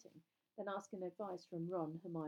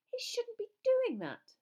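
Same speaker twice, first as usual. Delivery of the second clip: very high, loud, slow disapproval; shocked; 10 dB louder than usual. A narrator's reading. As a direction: draw it out, scandalised and high-pitched. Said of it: "looking very shocked".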